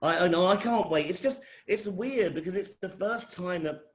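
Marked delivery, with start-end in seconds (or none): none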